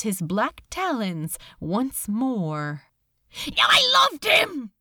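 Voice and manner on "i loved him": high, loud furious scream; angry scream. Acted. Crowley defying Beelzebub, angry scream. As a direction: hurl it at him in anger. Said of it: "Crowley screamed, not in fear this time, but in anger".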